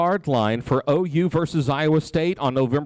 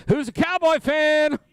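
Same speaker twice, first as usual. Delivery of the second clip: high teasing call to the room; calling out to the crowd; 10 semitones higher than usual. Real, not acted. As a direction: egg the fans on, pitched up.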